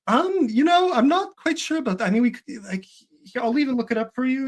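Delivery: high-pitched, hesitant offer to help; tentative polite correction. Retold or imitated. Student gently correcting strangers who were sure they were right, tentative.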